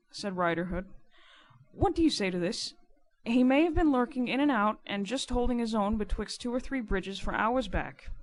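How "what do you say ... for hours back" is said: louder, insistent character voice; emphatic riverman arguing his case; lightly voiced rough informer. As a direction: press the point as the informer. Narrator voices Riderhood arguing.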